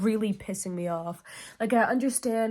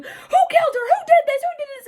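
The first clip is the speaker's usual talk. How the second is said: high, frantic mock shriek; mock screaming; 7 dB louder, much faster than usual. Reader imitating everyone arguing over a killing, mock screaming. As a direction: imitate a panicked, arguing crowd. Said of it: "everyone is screaming, arguing".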